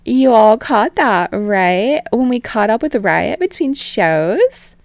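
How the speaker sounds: grinning, pleased with herself, playing to the audience; smug. A narrator's reading. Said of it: "Utsushimi grins smug".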